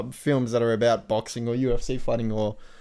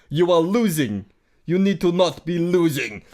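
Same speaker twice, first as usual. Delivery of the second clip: 1 mock Russian accent; 4 semitones higher than usual. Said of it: "doing that accent".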